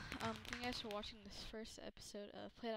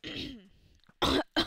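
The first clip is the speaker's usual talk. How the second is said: loud rough coughing; harsh coughing fit; 6 semitones higher, 16 dB louder than usual. Real, not acted. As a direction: cough hard, throat raw. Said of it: "my throat is terrible".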